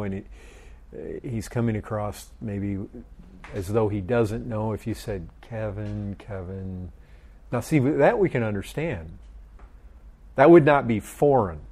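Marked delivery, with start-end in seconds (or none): 5.4-6.9 soft, low, gently corrective; different inflection. Pretend. Speaker to Kevin who seems naive, quiet knowing inflection. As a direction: say the name twice, quietly, sinking lower. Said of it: "saying it with a little bit different inflection".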